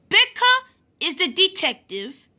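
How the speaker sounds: usual speaking voice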